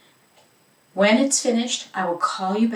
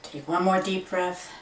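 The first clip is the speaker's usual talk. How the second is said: guided meditation, fading. Acted. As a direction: let the voice sink away with each exhale. Guiding breathing, fading.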